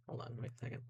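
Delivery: whispered aside to herself; whispering. Real, not acted. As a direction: murmur under breath. Muttering to self.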